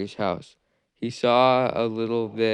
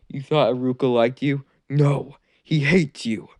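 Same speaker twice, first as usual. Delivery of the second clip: cruel taunt; 4 semitones higher, 6 dB louder than usual. Acted. Villain taunts Naruto cruelly.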